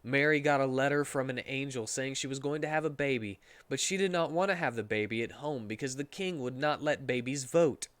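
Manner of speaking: ordinary talk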